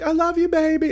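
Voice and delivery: campy diva voice, way up high. Retold or imitated. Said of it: "who did [name] live".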